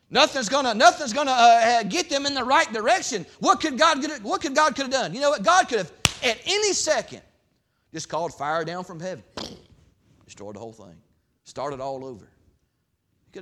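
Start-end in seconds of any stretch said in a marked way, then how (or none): 0.1-9.2 loud, high preaching voice; full-force pulpit preaching. Acted. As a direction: preach it hard to the congregation.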